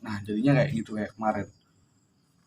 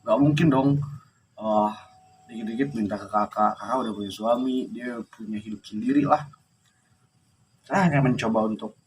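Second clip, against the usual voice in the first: ordinary talk, nothing marked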